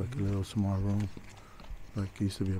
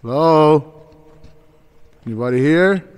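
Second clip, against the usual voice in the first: loud, high challenge to the empty rooms; calling into the dark; 7 semitones higher, 16 dB louder, slower than usual. Real, not acted. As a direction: taunt the ghosts loudly. Taunting spirits in the dark.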